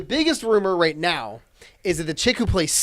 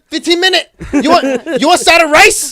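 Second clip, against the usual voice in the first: high, loud hammed-up broken English; loud mocking caricature voice; mock foreign waiter; 12 semitones higher, 13 dB louder than usual. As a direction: ham up a pushy waiter voice, much higher.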